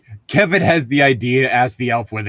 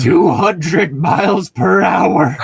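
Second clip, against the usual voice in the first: lightly voiced bragging player, slow and louder on the numbers; mock boast; 3 semitones higher, 4 dB louder, slower than usual. A joking player brags about rowing two hundred mph, slow and loud. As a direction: puff up like a proud rower.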